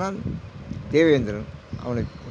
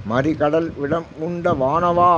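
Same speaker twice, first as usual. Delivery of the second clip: drawn-out devotional intoning; slow verse recitation; 4 dB louder, slower than usual. Acted. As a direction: stretch each word of the hymn slowly. (மெதுவாக பாடல் ஓதுதல்)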